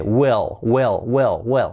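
"will" with vowel near E, then L; correct will. Shown as a demonstration. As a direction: drill "will", almost "well". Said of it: "Así sería". ("will" repetido, casi con e)

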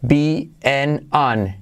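"Be and an" slowly, the middle word as "en", the last as "an"; vowels shown. Shown as a demonstration. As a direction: separate "Be and an" for learners. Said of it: "I como E". ("Be and an" lento, como "bi, en, an")

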